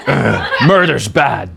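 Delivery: disgusted detective. Acted. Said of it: "like an improv scene".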